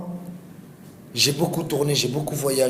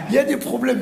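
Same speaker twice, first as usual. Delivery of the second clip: laughing; 11 semitones higher, 3 dB louder, faster than usual. Real, not acted. Nervous joke on Kadhafi.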